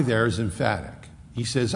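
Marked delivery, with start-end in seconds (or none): none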